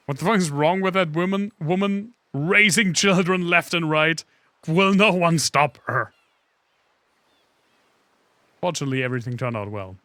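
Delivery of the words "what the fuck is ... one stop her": loud, high, slowing outrage; outraged. Real, not acted. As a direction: rant it at full volume. Outraged rant at the game.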